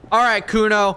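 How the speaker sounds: excited rallying call; psyched-up call. Real, not acted. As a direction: hype yourself up for the big moment. Psyching up before a dice roll.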